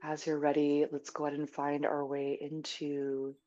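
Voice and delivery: soft, unhurried meditation guidance; guided meditation. Real, not acted. Teacher settling a group into stillness before a sit, soft guided meditation. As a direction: ease them gently into stillness.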